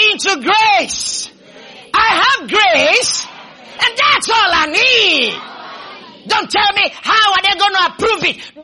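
high, slow shouted declaration; full-force preaching. Real, not acted. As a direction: lead the congregation line by line at full voice.

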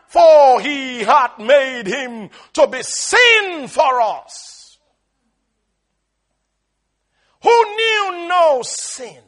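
loud, slow scripture proclamation; booming, drawn-out pulpit delivery; full-force preaching. Acted. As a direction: stretch each key word and let it ring.

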